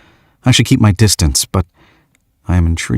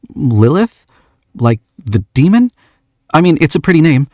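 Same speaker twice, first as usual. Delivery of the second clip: put-on lightly higher young man's voice; shy young man; 10 semitones higher than usual. George asks the stranger why she came, lightly shy. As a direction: ask with awkward shyness.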